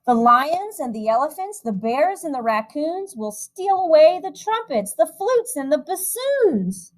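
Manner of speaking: lilting storybook reading voice; rhythmic, gentle picture-book narration; reading to children. A narrator's reading. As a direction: read the rhyme aloud to a room of kids. Reads a rhyming picture book.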